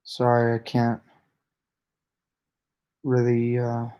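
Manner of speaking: drowsy, fading words; half asleep, trailing off; nodding off. Real, not acted. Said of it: "I'm falling asleep, guys".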